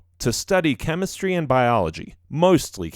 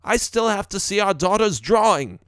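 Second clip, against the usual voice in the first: higher-pitched dad voice; father calling back; 9 semitones higher than usual. A narrator's reading. Father delaying dinner for daughter's drawing, calling back. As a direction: protest in a raised pitch.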